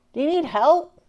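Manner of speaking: high, loud rustic voice; a hint of a toothless hillbilly offering help; hillbilly helper.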